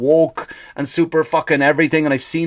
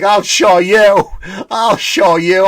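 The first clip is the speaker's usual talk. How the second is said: loud, higher-pitched defiant vow; acted defiance; 6 semitones higher, 5 dB louder, slower than usual. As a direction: vow loudly to prove the doubters wrong. Acted stage builder defies his doubters.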